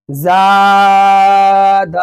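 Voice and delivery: very long level aa in "ज़ाद", short ending; drawn-out vowel. Shown as a demonstration. Teacher showing alif madda, long held vowel. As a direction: stretch the first vowel of "ज़ाद" slowly. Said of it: "जा को क्यों खींच रहा हूँ".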